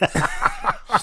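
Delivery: high squealing laugh. Real, not acted.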